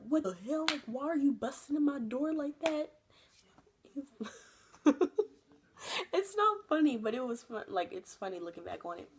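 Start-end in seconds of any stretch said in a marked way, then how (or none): 0.1-2.9 higher, louder startled dad voice; a dad caught off guard. Retold or imitated. Dad to daughter bursting in, a startled hint of anger. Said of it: "my dad's like". 4.9-7.0 much higher, louder through laughter; laughing. Real, not acted. Telling the viewers, laughing high.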